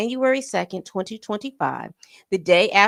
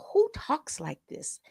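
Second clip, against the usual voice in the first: breathy disbelieving whisper, higher; incredulous whisper; 6 semitones higher, 7 dB quieter than usual. Real, not acted. Reacting to the text, whispered disbelief. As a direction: hush it out in shock.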